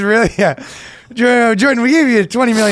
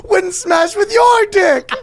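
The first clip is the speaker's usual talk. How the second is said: high mock Peterson; 14 semitones higher, much slower than usual.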